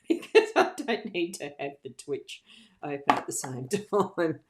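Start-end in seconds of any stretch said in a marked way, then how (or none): none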